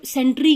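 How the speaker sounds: सेंट्री with the final written e sounded as a long "ee"; wrong silent-e reading. Shown as a demonstration. Teacher asking why pupils don't say it, सेंट्री ending in "ee". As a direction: end सेंट्री on "ree" instead of "ter". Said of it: "क्यों नहीं बोलते हैं".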